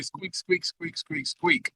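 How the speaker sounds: soft squeaky mouse chatter; mouse squeaks. Acted. Game master voicing a hungry mouse, squeaking softly.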